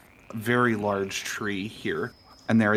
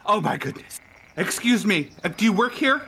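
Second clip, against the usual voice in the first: fussy guest voice; 8 semitones higher, 4 dB louder than usual. Acted. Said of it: "and he's like".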